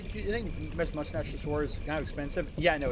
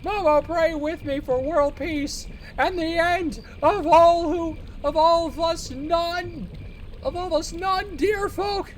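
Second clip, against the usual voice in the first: put-on high, slow, loud prophetic old man; 10 semitones higher, 7 dB louder, slower than usual. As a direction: proclaim doom like a crazed grandpa. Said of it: "the old man voice".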